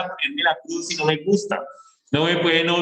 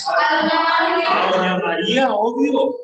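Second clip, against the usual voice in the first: high, slow, stretched A doña María; a teacher stressing the obvious answer to the class; drawn-out emphatic answer; 8 semitones higher, 3 dB louder, much slower than usual. Real, not acted. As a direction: drag out "A doña María" to make the point. Answering own question emphatically.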